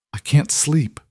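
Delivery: put-on small scared child. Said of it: "Max's voice was so small, so frightened".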